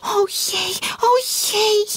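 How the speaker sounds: high lamenting goose; high whimpering goose; wailing. Acted. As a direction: wail oh dear. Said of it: "jammerde Gansje".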